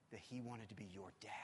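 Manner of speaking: tender whispered confession. Real, not acted. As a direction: lean in and whisper it. Intimate climax of sermon.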